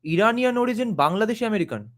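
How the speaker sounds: high, mocking repeat of a viewer's label; the host repeats a viewer's label, mocking and incredulous; incredulous echo. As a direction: echo it back in disbelief. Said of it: "what is this thing called Iranian origin".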